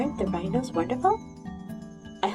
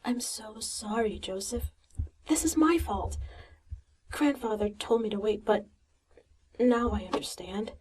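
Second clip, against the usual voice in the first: softer, half-whispered regret; a guilty girl comforting a friend; sad apology; 3 dB quieter than usual. Acted. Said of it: "With her eyes lowered".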